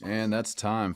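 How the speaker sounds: usual speaking voice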